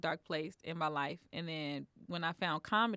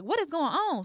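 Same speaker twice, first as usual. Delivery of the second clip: mock alarmed mother; 10 semitones higher, 8 dB louder than usual. Girl's mother arriving home.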